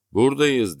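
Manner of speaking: loud, high call from far off; calling from the dark. A narrator's reading. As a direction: answer back out of the darkness. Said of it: "dedi sesi karanlıklardan". (karanlıktan yüksek sesle seslenerek)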